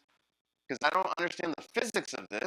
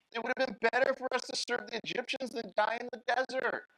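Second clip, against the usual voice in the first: complaining Israelites; 8 semitones higher than usual. Acted.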